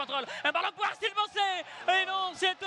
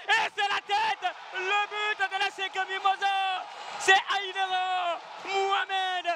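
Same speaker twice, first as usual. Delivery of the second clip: excited goal call; slower than usual. Real, not acted.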